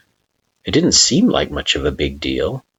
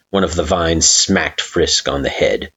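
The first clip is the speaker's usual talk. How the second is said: ordinary delivery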